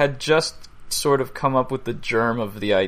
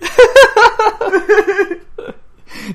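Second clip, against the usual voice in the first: high, loud burst of laughter; big laugh; 10 dB louder than usual. Real, not acted. Host cracking up at a terrible accent attempt, high loud laugh. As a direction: crack up at length.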